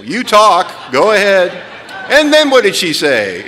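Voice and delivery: loud, high sponsor prompting; hammed-up impatient counsellor; mock eager listening. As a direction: prod them loudly to keep talking.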